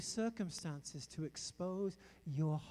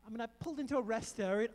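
ordinary talk, nothing marked

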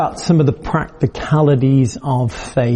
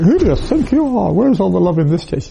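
high, affronted church-lady huff; mock indignant protest, pitched high; indignant mock outrage; 4 semitones higher than usual. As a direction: play it offended and huffy. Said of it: "instead of going like".

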